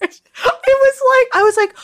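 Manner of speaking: ordinary talk, nothing marked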